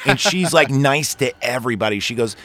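usual speaking voice

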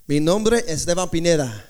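low-pitched Spanish-accented introduction. Shown as a demonstration. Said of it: "I sound like I speak Spanish, right? I have the accent".

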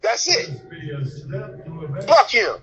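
words muffled by a mouthguard; wearing a mouthguard. Shown as a demonstration. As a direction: talk around a mouthpiece. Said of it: "That's what I had the mouthpiece in".

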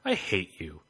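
low, deadpan mock threat; understated menace, played for comedy; retold teacher threat. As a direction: imitate a teacher's blunt, low-pitched threat. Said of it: "out of nowhere and goes".

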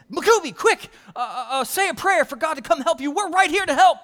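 high, loud pretend panic with a laugh; mock urgency. Pastor pleading with the pretend-dead boy, high loud mock panic.

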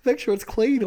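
high, slow pleading; mock concern. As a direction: plead like a worried parent.

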